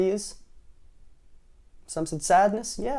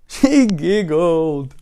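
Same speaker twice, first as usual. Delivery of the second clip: loud, very drawn-out higher cry; storybook king bursting into tears; wailing king; 4 semitones higher, 6 dB louder, much slower than usual. Acted. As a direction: stretch it into a hammed-up wail. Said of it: "went on the king".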